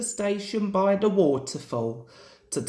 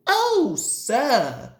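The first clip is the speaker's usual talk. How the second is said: happy; 5 semitones higher, 4 dB louder than usual. A narrator's reading. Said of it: "said Sir Handel happily".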